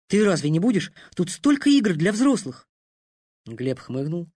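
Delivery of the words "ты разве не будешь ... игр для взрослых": put-on high, eager little boy; a small boy begging his dad; eager child voice. A narrator's reading. Son urges father eagerly.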